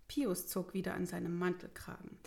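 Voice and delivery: plain reading voice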